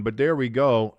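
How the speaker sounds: normal talking voice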